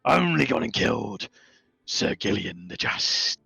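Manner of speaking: mimicking Krim's words like a Kenku; lightly voiced parroting bird creature; Kenku mimicry. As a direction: echo the words back as a Kenku. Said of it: "responds, being a Kenku".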